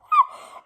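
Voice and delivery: very high, loud squeal; short acted-out fangirl shriek; starstruck squeal. Fan losing it before her idol, squealing.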